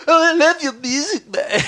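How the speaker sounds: high, loud starstruck gush; slow, swooning fan voice. Retold or imitated. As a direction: play the overexcited fan meeting an idol. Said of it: "'Cause I don't wanna sort of, like, go".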